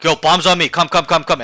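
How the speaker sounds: urgent calling out. Shown as a demonstration. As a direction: summon teammates with repeated urgent shouts. Demonstrates urgently calling teammates over.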